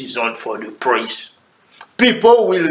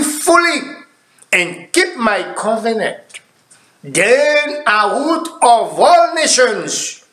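preaching scripture; 8 semitones higher than usual. Acted. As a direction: read the verse like a preacher lifting the crowd. Preacher reading scripture with force.

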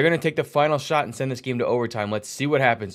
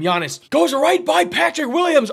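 high-pitched surge of excitement; thrilled play call; 11 semitones higher, 3 dB louder than usual. Real, not acted. Commentator as Giannis drives by Williams, thrilled play call.

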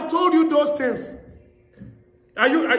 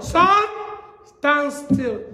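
commanding call; 2 semitones higher, much slower than usual. Retold or imitated. Preacher voicing Joshua ordering the sun, commanding call. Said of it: "And he told the sun".

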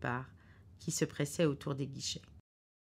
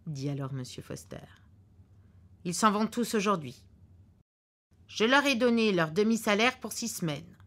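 the husband's voice, louder and a bit higher, certain of himself; gruff husband; 4 semitones higher, 7 dB louder than usual. Acted. Husband in the car predicting a cancelled flight, curtly sure. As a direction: lay out the bad news like a know-it-all husband.

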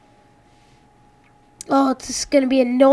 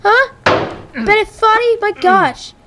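high-pitched, exasperated telling-off; raging rant; 6 semitones higher than usual. Real, not acted. Player snapping at the game's talkative narrator, raging rant. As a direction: rant back at him, pitch way up. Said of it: "now I'm raging".

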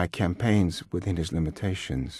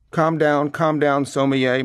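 raised, louder mock soothing; overbearing and patronizing; condescending; 6 semitones higher, 5 dB louder, slower than usual. A narrator's reading. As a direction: talk down to the waiter. Boisterous father mocking an old waiter.

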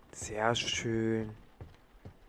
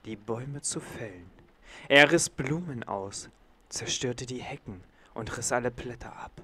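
bedtime story reading. Acted. Reading a bedtime story to the baby in a hushed voice. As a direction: read it like a lullaby to a little one.

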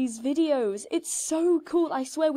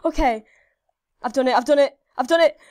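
loud; 8 dB louder, faster than usual. Real, not acted. Celebrating the milestone.

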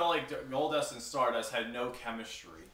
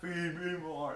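drawn-out mock wrestler chant; lightly hammed-up catchphrase, higher pitched; Ryback; 4 semitones higher, slower than usual. Repeating the catchphrase for co-hosts, mock wrestler chant. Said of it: "Remember the way he said".

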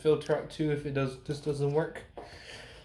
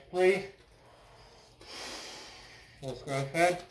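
muffled behind mask filter; speaking inside sealed respirator; through a gas mask; 2 semitones higher than usual. Real, not acted. Seen 2: Full-face gas mask worn over mouth throughout the labelled words.

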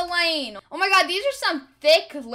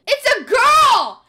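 high, drawn-out triumphant yell; mock announcement shout; 8 semitones higher, 9 dB louder, slower than usual. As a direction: yell it slowly like big news. Mock announcement while trolling.

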